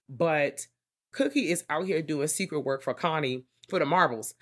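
usual speaking voice

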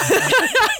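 a burst of high delighted laughter. Real, not acted. Host laughing high at his own Gen X joke. Laughs after mock insult.

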